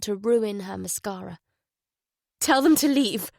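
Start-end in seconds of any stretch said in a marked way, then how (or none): 2.4-3.3 louder, higher insistent plea; hollow repeat. Acted. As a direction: push it out, desperate and empty. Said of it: "she repeated hollowly".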